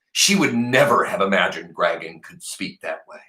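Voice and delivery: plain reading voice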